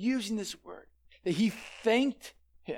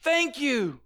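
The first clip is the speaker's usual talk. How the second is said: fading shout; 4 semitones higher than usual. Retold or imitated. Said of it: "he's just like".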